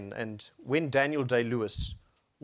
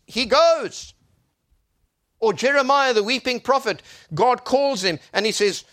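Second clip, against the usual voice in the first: loud, higher preacher's delivery; impassioned preaching; 9 semitones higher, 10 dB louder than usual. Real, not acted. Preacher declaring God's word to the congregation, impassioned preaching. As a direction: proclaim it with full force.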